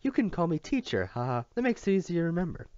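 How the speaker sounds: high, loud, forced-cheery teacher with a fake laugh; hearty teacher voice. Teacher joking to the boy in high forced cheer. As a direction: play the odd teacher laughing at her own joke. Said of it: "The laugh was weird".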